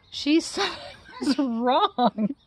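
laughing through the words; slow line broken by a laugh. Acted. Laughs while quoting.

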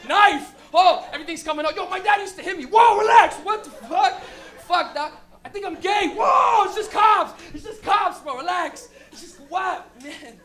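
high loud frantic shouting; panicking friend. Retold or imitated. Boy in back seat panicking at cops, frantic shout. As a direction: lose it completely in terror. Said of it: "Your boy's in the back".

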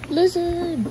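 a quick gasp at a lizard; startled gasp. Real, not acted. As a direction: caught off guard by a lizard. Spots a lizard.

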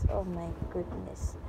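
usual speaking voice

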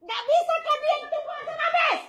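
high, sharp warning; real angry threat. Real, not acted. Threatening the neighbours, voice rising.

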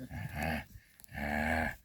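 usual speaking voice

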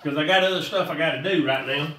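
rushed, fast delivery; quick, hurried explainer pace; talking fast. Real, not acted. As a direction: hurry through it, short on time. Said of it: "I'm talking kinda fast".

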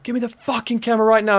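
high-pitched imitated robber's order; retold angry demand. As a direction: act out a threatening demand, not shouted.

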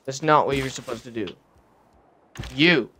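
ordinary delivery

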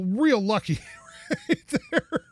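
laughing through the words; chuckling with relief. Real, not acted. Laughing at lucky break.

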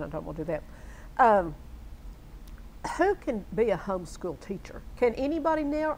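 ordinary talk, nothing marked

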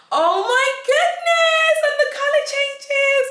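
squeal of delight. Real, not acted. Vlogger gushing as the nightlight changes colour, squealing. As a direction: gush over the glowing lights. Light show delights her.

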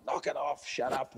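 mock shout at a barking dog; shouting without the volume; retold shout. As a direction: imitate a fed-up owner's shout, held back.

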